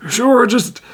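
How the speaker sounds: a queasy creature waving you off; sick orc voice. Acted. Nauseated orc telling Tijim to leave, miserably.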